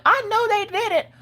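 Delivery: high, knowing bystander voice; a passerby who's sure something's wrong; lightly voiced suspicious stranger; nosy onlooker. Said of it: "look at that child like".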